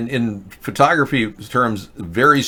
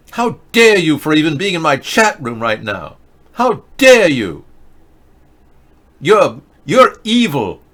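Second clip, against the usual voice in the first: mock Greta rant; 4 semitones higher, 4 dB louder than usual. Host scolds chat in mock Greta outrage, louder and higher.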